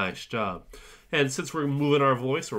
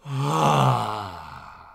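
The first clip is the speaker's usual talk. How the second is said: bigger, louder sigh; 5 dB louder than usual. Shown as a demonstration. Teacher modelling bigger sigh for students, loud sigh. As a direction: sigh it out fully. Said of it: "a bigger sigh".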